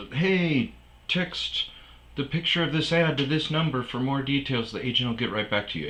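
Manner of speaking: reciting a scammy rental comment in a put-on voice; mock scam ad voice. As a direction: read it like a spammy bot message. Reading out scam comment text.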